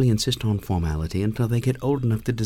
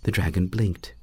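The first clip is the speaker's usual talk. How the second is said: usual speaking voice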